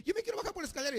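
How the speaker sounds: high, whiny mock baby pleading. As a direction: play a small child begging to go downstairs. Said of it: "y el bebé dice". (voz aguda de bebé que insiste en bajar)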